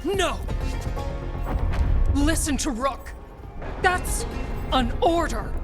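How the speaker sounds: high, strained order; urgent command. Acted. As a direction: push the order out in separate bursts. Dying or defeated commander reverses order.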